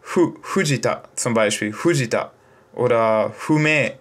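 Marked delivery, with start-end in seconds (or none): 0.4-2.3 "Fujita" said as a breathy "Hujita"; "Fujita" with the Japanese Hu sound, lips rounded. Shown as a demonstration. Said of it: "aber Hu".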